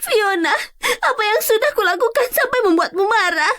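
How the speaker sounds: high, hurt crying voice; tearful. Acted. Wronged colleague asking Fiona why she hates her, tearful. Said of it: "kenapa Anda menangis".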